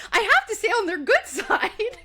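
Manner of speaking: high mock complaint with a laugh breaking in; mock exasperated sister. Stassi fretting about her sister, high and laughing. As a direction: play the flustered sister, laughing through it. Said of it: "like, getting mad".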